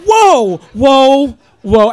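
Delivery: hammed-up loud whoa; freaked-out whoa. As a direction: reel back in stoned alarm. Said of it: "You're like".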